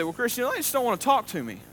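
lightly voiced excuse from a listener; mock objection. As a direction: whine like a reluctant listener. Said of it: "And you say".

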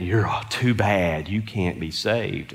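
Satan slowly condemning; low, slow taunt from Satan; Satan's voice. Retold or imitated. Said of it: "Satan's saying".

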